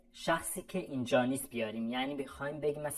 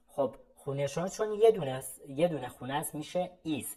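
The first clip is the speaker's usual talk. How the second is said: ordinary delivery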